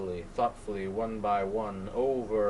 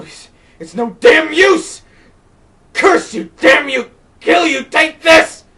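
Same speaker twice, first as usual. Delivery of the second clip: much higher, much louder shrieked curses; raging scream; 19 dB louder than usual. Acted. Cursing the wind, raging.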